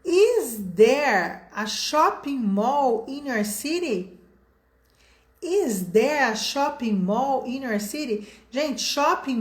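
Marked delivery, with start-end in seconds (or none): 0.0-4.2 slow model sentence. Shown as a demonstration. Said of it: "Então, olha só".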